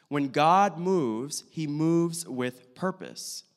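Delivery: slow, deliberate repeat of the key point; measured, emphatic and slow; slow emphasis. Real, not acted. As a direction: draw out each phrase so it lands. Key point repeated for note takers.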